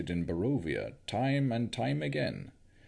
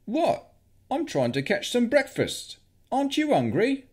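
put-on much higher, innocent-sounding young man; cheeky young wizard; 5 dB louder than usual. A narrator's reading. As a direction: play it cheeky, all innocence. Victor's cheeky reply.

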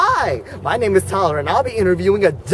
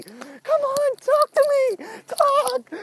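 hammed-up high whine of a plea; high-pitched pleading; 13 semitones higher, 5 dB quieter, much slower than usual. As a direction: beg in a very high voice. Begging ducks to talk.

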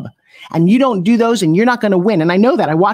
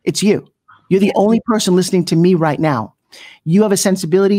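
ordinary talk, nothing marked

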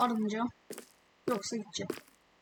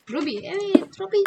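excited; 7 semitones higher, 7 dB louder than usual. Real, not acted. Finds a ruby in mine.

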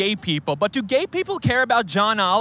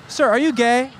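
normal talking voice

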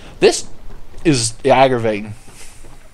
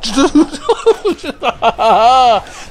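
loud high-pitched laughing cry; high outburst; 12 semitones higher, 5 dB louder than usual. Real, not acted. Reacts to a mishap in game.

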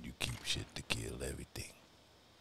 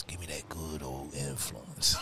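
slow, louder, relishing drawl; gloating; 6 dB louder, slower than usual. Real, not acted. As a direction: savour every word. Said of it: "savouring the loot".

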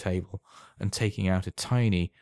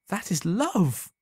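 high, slow, rapturous cry; ecstatic cry; 11 semitones higher, 3 dB louder, slower than usual. A narrator's reading. Alan marvelling at the promised devotion, high rapturous cry. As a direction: exclaim it like a revelation. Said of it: "cried Alan".

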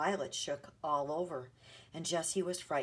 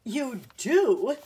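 loud, high, delighted surprise; astonished cry; 6 semitones higher, 11 dB louder than usual. A narrator's reading. Man hearing a child likes him, astonished cry. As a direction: cry out as if hardly believing it. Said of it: "cried the man".

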